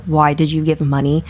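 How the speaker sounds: normal talking voice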